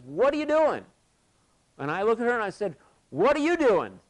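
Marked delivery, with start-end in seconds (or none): 0.1-0.9 high, loud mock-alarmed shout; imitating startled yell. Climber to belayer who yanked the rope tight, imitated startled yell. 3.1-4.0 loud, high-pitched shout thrown back; imitating challenging yell. Belayer answering the climber's startled question, imitated loud challenge.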